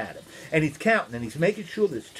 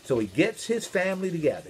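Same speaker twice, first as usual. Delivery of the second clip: ordinary delivery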